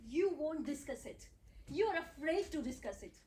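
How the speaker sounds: normal talking voice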